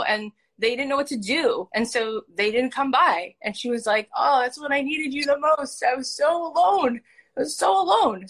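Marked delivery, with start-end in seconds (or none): 4.1-8.3 high, pleading complaint on a friend's behalf; retold hurt lament. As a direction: voice an abandoned friend's hurt, pitched up. Retells sick friend's hurt complaint.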